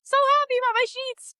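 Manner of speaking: high, fast, squealing "So happy about my sheets"; gleeful squeal. Shown as a demonstration. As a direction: gush "So happy" like a thrilled kid.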